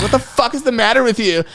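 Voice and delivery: high-pitched mock indignation; mimicked outraged date. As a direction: act out an appalled date's outburst. Retold angry date, second take.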